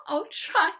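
high, tearful little reply. Acted. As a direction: agree through leftover tears. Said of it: "wiping her eyes".

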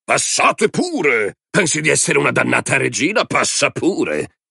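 high, drawn-out sneering invitation; mocking anger. A narrator's reading. Man waves woman past, mockingly. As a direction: drag out the words high and sarcastic.

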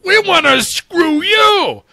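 high, slow mock bully shriek; ungov version. Host mocking the US trade stance, high shrieking threat. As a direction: squeal it like a bully making threats. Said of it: "Again, ungoving".

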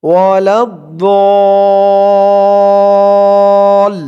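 ওয়ালাদ দল with "daw" held very long before the l; four-alif elongation. Shown as a demonstration. Teacher demonstrating for students, দল held extremely long. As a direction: stretch দল on one steady note. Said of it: "এরকম করে চার আলিফ টান দিয়ে".

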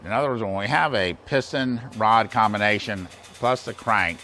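ordinary talk, nothing marked

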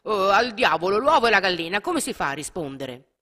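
a doctor giving up in exasperation; exasperated outburst. A narrator's reading. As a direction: throw up his hands in frustration. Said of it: "l'altro medico esclamò".

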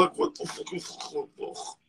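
soft, blocked-up mimicry; mock stuffy nose. Friend parroting his buddy's complaint back at him, mock stuffy-nosed voice. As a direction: imitate him as if badly congested. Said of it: "sound like he got a stuffy nose".

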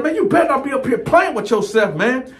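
elevated, exasperated cellmate. Retold or imitated. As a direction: climb into a high, outraged register. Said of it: "He done took me to my higher voice".

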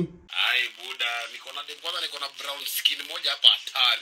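loud voice through a phone speaker; loudspeaker phone. Real, not acted. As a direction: friend bragging over speakerphone. Friend answering on loudspeaker.

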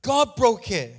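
loud, emphatic preacher's cry; full-force preaching. Acted. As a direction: hammer it home from the pulpit.

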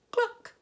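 high, loud clucking; hen cluck. A narrator's reading. Hens agreeing the plan, high loud cluck. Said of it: "said the hens".

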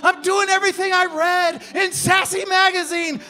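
high, loud protest; high, loud, defensive yelled excuse; defensive shout. Acted. His defensive answer.

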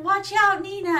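high, loud retold warning yell; shouted warning. Onlookers warning her of a flying ball, urgent shout. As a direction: call out an urgent mock warning. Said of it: "they're like".

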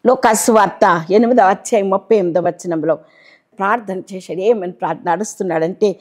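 usual speaking voice

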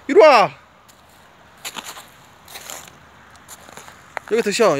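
loud high shout for the dog; calling out. Real, not acted. Owner calling his dog over to sit, loud shout. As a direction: yell so the dog hears from afar.

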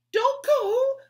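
high, loud pleading cry. Acted. Betsy begging the new girl to stay, loud cry. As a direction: beg her not to leave. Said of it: "cried Betsy".

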